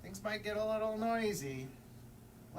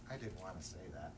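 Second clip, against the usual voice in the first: fast, much softer, much lower mumble; quiet aside; 11 semitones lower, 11 dB quieter, faster than usual. Real, not acted. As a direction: toss it off under your breath. Hushed aside after the ending.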